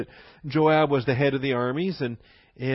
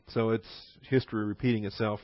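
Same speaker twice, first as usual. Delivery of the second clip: ordinary delivery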